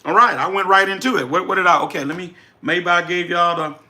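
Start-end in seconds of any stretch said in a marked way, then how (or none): none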